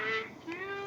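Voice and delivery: high squeaky voice; squeaky thanks. Real, not acted.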